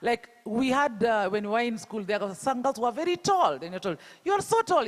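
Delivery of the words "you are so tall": high-pitched mock taunt; imitated teasing. Schoolmates shaming a tall girl, imitated teasing. Acted teasing about body, answered proudly.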